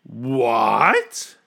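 a king's incredulous bellow, swooping upward; outraged bellow. A narrator's reading. King hears demand to apologize, bellowing. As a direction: roar it as an affronted question. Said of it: "he bellowed".

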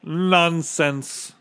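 high, scoffing captain; pompous, dismissive character voice; snorting dismissal. A narrator's reading. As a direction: scoff it away. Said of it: "snorted the captain".

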